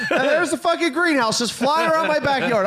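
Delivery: telling it high through laughter; can't stop laughing as he says it; laughing disbelief. Real, not acted. Laughing while telling the chaos.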